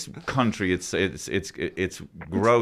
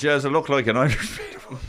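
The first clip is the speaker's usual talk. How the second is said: mock Irish; 4 semitones higher, faster than usual. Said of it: "some guy came on with red hair and he said".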